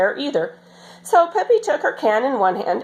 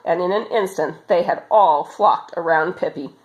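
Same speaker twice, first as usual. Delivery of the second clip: plain reading voice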